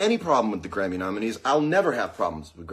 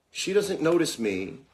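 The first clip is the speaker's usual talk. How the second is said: ordinary talk, nothing marked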